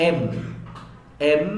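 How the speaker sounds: এম closing on both lips; lips-together M. Shown as a demonstration. Teacher drilling the letter M, lips together. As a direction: press lips together to end এম. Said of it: "দুই ঠোঁট একসাথে লেগে যাবে".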